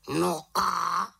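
high bird squawk; questioning raven. A narrator's reading. Raven urges the witch to go on, questioning squawk. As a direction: prod her as a raven.

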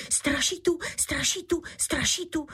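high, panicky repeated cry; vyděšené zvolání. A narrator's reading. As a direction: cry out high to warn the robbers. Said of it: "zvolal jiný loupežník". (vysoký poplašený křik)

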